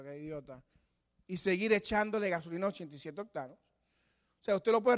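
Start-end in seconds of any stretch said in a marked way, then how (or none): 0.0-0.6 muttered under the breath, much softer; a private insult kept to yourself; inner scorn. Pretend. The hypocrite thinks of the preacher, softly scornful. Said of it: "Y por adentro".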